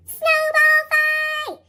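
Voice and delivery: loud, slow, high drawn-out shout; yelled. A narrator's reading. Froggy starting a snowball fight, long loud yell. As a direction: stretch the call to battle. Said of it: "yelled Froggy".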